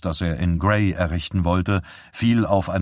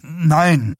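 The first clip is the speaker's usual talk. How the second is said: slightly calmer; 8 semitones higher, 4 dB louder than usual. A narrator's reading. Husband after wife breaks into tears, slightly calmer. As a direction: still raised, easing from the shout. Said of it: "sagte er etwas ruhiger".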